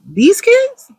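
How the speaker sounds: high-pitched disbelief; mock-astonished mother; incredulous. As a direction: voice leaps up in a baffled question. Mother's reaction to glowing school reports.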